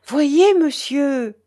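high, loud, drawn-out appeal; emotional exclamation. A narrator's reading. Marguerite showing the manuscript to monsieur, exclaiming. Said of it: "s'écria Marguerite".

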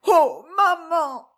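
slow, high, drawn-out wail; despairing cry. A narrator's reading. Woman in a crisis of tears calling her mother, despairing cry. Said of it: "s'écria-t-elle".

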